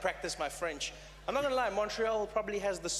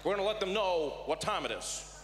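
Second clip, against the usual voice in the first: presidential impression, talking tough; Barack Obama. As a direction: deliver a warning as the president. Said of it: "Barack Obama, he was there, he's like".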